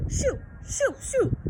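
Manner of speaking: high shooing cry. A narrator's reading. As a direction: shoo the dog away up high. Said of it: "the manager cried".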